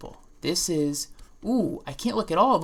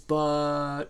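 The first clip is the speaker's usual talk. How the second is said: shrugging close enough; 12 semitones higher, much slower than usual. Acted. Shrugging voice, high and far.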